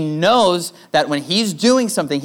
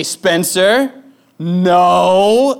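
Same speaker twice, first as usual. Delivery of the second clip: put-on louder, sharper warning to a toddler; 5 dB louder, much slower than usual. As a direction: snap the no like it's urgent. Dad stops toddler at socket.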